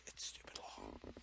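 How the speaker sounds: breathy whisper under the breath; whispered aside. Real, not acted. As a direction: hiss it as a private aside.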